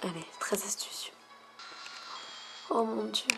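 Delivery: unvoiced hopeful whisper. Real, not acted. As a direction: whisper it like a wish. Hoping for a trait.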